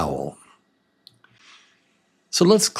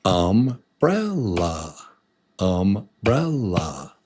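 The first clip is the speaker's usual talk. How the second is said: Umbrella slow, in three separate beats; syllable by syllable; slower than usual. Shown as a demonstration. Teacher showing how many to clap, Umbrella slow and split. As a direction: break Umbrella into um, brel, la. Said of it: "How many".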